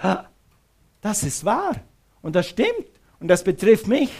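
retold moment of startled realization, pitch jumping up; plötzliche Erkenntnis. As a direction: act out a sudden "that's me!" insight. Acting out his sudden conviction. (nachgespielte verblüffte Erkenntnis)

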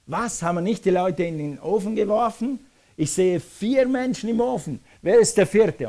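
high, astonished outcry; king crying out. Acted. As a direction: exclaim in rising shock as the king. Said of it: "schreit der König und schreit auf".

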